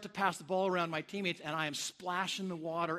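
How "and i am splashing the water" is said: usual speaking voice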